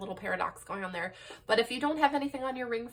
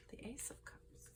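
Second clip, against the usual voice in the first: whispered; 4 semitones higher, 19 dB quieter than usual. Real, not acted. Card read softly to herself.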